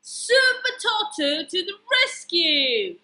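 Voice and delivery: loud, high superhero fanfare; rousing and triumphant; heroic announcement. A narrator's reading. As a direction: proclaim the hero's grand arrival. Hero arrives in the story.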